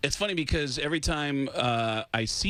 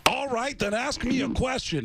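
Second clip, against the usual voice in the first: high-pitched, emphatic challenge; 10 semitones higher than usual. Retold or imitated. Fake rock star proves identity to assistant, emphatic. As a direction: pompously dare them to test you. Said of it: "And I said".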